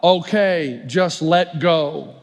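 deadpan divine advice; voice of God. Acted.

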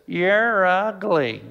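very slow, drawn-out parrot. Acted. Parrot in store window to passing woman, drawn-out taunt. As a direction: stretch each word like a taunting parrot. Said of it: "and the parrot says".

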